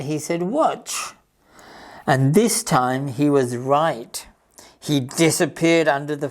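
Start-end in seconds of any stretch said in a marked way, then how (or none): 0.4-1.1 high little show-off call; bright boast. Acted. Rabbit showing the animals he can dig under the fence, proud. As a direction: set up the trick proudly.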